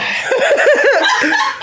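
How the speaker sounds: high pained laugh. Real, not acted. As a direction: laugh through the burning mouth. Laughing through spicy pain.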